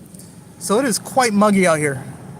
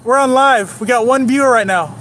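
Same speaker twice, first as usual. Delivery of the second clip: much higher and louder announcement; calling out; 5 semitones higher, 6 dB louder than usual. Real, not acted. Telling passersby he's streaming, loud calling out. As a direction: shout it out the window cheerfully.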